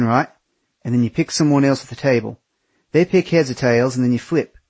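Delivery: put-on Aussie accent explaining a drinking game; Australian accent. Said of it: "he said in his thick Australian accent".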